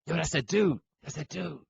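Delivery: a mock hoops guy voice; lightly voiced pickup-game bystander. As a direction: play the trash-talking pickup guy. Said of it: "so you can change the accent".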